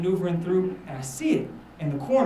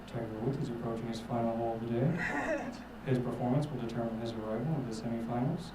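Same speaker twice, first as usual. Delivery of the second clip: low, soft play-by-play in a hush; golf commentator; 7 semitones lower, 8 dB quieter than usual. Acted. Commentator as Tiger Woods nears his final hole, hushed low voice. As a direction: narrate the crucial putt low and quietly. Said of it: "and then I hear it again".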